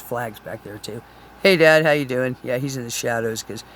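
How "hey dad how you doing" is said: louder call to Dad; greeting her dead father's flag, louder. Real, not acted. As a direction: hail him cheerfully across the room. Greeting dad's flag aloud.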